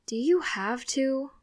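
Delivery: slow, whiny grumble through cereal; teen complaining over breakfast; mouth-full complaint.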